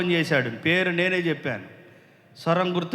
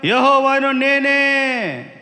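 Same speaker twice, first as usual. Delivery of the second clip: high, loud, slow preaching call; a preacher lifting his voice over the music; full-force preaching; 6 semitones higher, 5 dB louder, slower than usual. Acted. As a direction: proclaim it slowly to the whole church. Preaching on Moses at sea.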